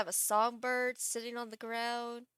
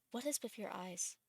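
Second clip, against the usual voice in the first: ordinary talk, nothing marked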